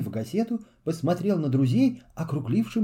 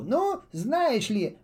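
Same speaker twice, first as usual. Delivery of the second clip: slow indignant drawl; 7 semitones higher, much slower than usual. Acted. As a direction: take offense, stretching it out. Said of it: "возмутился Пол Ботинка".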